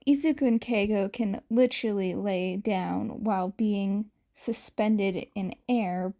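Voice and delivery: usual speaking voice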